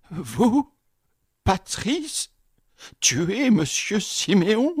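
montées très aiguës sur chaque "Vous"; répète, sidéré; effaré. Acted. Concierge effaré. (le concierge sidéré questionne Patrice, très aigu)

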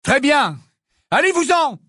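cry out. Acted. Harry yelling at the empty portrait, loud. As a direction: shout at the empty frame. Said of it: "s'écria Harry".

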